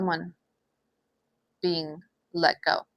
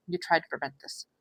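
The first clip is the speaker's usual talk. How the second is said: softer, hushed repeat of the line; soft echo; 9 dB quieter than usual. Real, not acted. Reader gently repeating it to the viewer, softly. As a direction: let it sink in, much softer.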